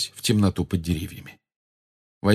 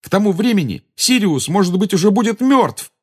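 high, louder outburst of frustration; exasperated exclamation; 11 semitones higher, 4 dB louder than usual. A narrator's reading. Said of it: "воскликнул Гарри, в сердцах". (восклицание в сердцах, высоко и громко)

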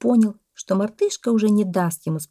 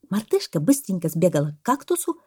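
ordinary delivery, nothing marked

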